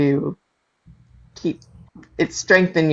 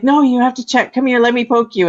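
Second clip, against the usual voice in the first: a higher, insistent mom voice; mom insisting; 8 semitones higher than usual. Retold or imitated. Mom insisting her daughter get checked, higher insistent voice.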